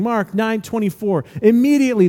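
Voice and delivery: ordinary talk, nothing marked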